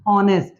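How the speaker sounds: ऑनेस्ट with the H silent, starting on the vowel. Shown as a demonstration. English teacher showing students the silent H: ऑनेस्ट begun on a vowel. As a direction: drop the H in ऑनेस्ट. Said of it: "हॉनेस्ट हम नहीं बोल रहे हैं".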